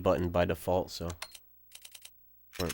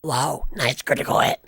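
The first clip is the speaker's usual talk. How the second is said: rough, scraped-throat smoker rasp; raspy smoker voice; 6 semitones higher, 6 dB louder than usual. Shown as a demonstration. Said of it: "fucked up throat from forty years of smoking voice".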